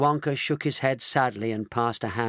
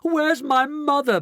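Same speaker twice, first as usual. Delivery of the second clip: high, loud, drawn-out demand; bawling; 6 dB louder than usual. A narrator's reading. Daughter demanding her vanished mother, bawling. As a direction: bawl it high and slow. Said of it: "bawled Missus Bucket".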